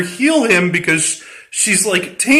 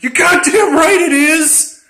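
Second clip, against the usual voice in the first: mock defiant shout; 9 semitones higher than usual. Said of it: "And he's like".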